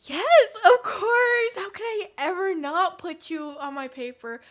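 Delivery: high, loud, slow sugary mock sincerity; fake sweetness. Lying to the girl about her paper, sugary fake sincerity. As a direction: gush it overly sweet to hide the lie. Faking reassurance to unwanted roommate.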